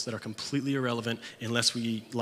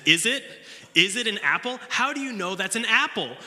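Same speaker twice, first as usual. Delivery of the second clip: high, loud incredulous questioning; mock leader challenge; 10 semitones higher, 5 dB louder than usual. Leader grilling a scout about the apple, high and loud. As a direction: needle the kid, pitched up and loud.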